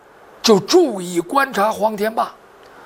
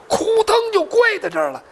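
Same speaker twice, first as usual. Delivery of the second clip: ordinary delivery, nothing marked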